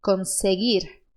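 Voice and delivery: conseguir slow with a tapped final r. Shown as a demonstration. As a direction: tap the r at the end of conseguir. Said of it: "a gente diz conseguir".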